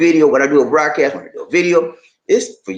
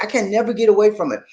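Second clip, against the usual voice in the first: ordinary talk, nothing marked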